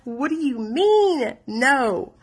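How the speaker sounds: high, drawn-out disbelief; retold freak-out. Daughter refused help by her parents, acted-out high, slow freak-out. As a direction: act out stunned protest at a refusal. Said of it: "I'm freaking out".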